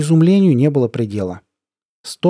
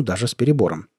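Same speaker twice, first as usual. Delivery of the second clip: ordinary delivery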